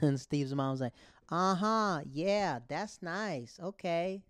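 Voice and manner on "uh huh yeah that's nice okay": high, slow, unimpressed; dismissive mom. Retold or imitated. A mom brushing off the preaching attendant, slow and dismissive. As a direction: humor him politely.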